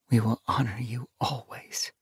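hushed, whispery vow; half-whispered and reverent; murmured. A narrator's reading. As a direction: murmur it very softly, like a private promise. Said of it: "she murmured".

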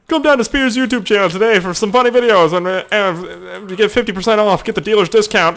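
high, loud car-dealer ad pitch; ad parody. Pretend. Parodying every TV commercial as a pushy dealer ad pitch. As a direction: sell it like a local TV commercial. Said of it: "like every fucking commercial".

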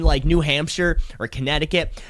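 usual speaking voice

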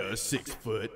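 deepened voice imitating a tall guy; mock deep macho voice. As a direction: puff up and say it deep. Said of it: "how deep he said six foot".

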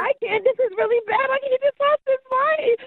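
1 crying. Real, not acted. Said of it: "stop crying".

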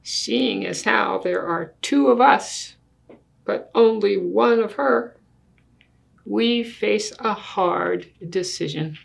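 put-on lively storybook read in a child's earnest voice; reading to children. Child argues with Daddy over the big bed, earnest storybook reading. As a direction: read it like a kid making a serious case. Reads picture book aloud.

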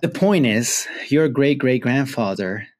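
normal talking voice

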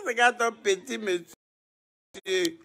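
slow, amused repeat of her line; laughing imitation. Real, not acted.

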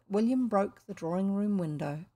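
plain reading voice

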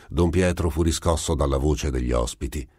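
ordinary delivery, nothing marked

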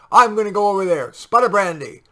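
high, loud heroic captain; bold loud declaration. A narrator's reading. Captain telling sergeant he'll fly, loud and bold. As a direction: proclaim it over the guns. Captain decides over bombardment.

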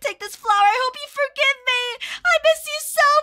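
hammed-up weepy farewell; tearful mourning. Woman at her friend's grave, acted tearful grief.

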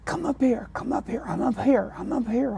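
high, soft call from up on the scaffold; calling down from above. Retold or imitated. Curator calling from the scaffold, a high soft beckon. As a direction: beckon him up from a height.

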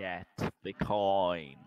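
loud, slow, drawn-out order; insistent command. Real, not acted. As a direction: stretch each word to nag the player. Nagging friend to get coin.

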